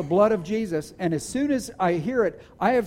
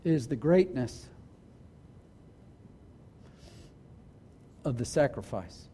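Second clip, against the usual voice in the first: low, soft, with long pauses; hushed solemnity; 6 semitones lower, 11 dB quieter than usual. Real, not acted. Preacher dwelling on Christ's sacrifice before the congregation, hushed and solemn. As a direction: let each phrase sink in, quiet and weighty.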